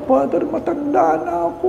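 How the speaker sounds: high, soft pleading on matanda; frail grandmother gently pleading matanda na ako; quiet high elderly excuse; soft old lady plea. Retold or imitated. Old lady explains to priest.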